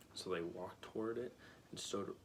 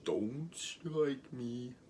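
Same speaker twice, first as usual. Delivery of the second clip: Oz pleading; 3 semitones higher, 4 dB louder, much slower than usual. A narrator's reading. Oz pleading in read story.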